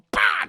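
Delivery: loud, sudden "bah"; punchy burst as a kick lands; kick noise. Acted. Storyteller mimics the detective's kick, loud burst.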